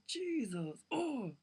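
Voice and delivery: slow, drawn-out exasperated groan rising high; exasperated outburst. Real, not acted. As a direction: let it out in disbelief. Exasperated rant about the fanfic.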